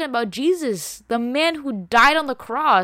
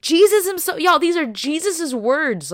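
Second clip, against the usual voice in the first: higher and louder, fired up; excited burst; 7 semitones higher, 6 dB louder than usual. Real, not acted. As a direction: preach it out with fire. Preacher erupting about Jesus's words.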